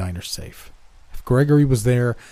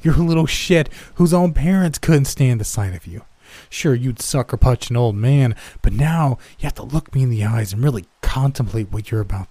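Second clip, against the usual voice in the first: loud, high insults full of contempt; scornful shouting; 4 semitones higher, 5 dB louder than usual. Acted. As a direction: tear him down, yelling. That annoying know-it-all laugh.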